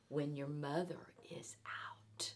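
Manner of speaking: fish whispering a warning. Acted. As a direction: hush it like a secret. Fish warns secretly about cat.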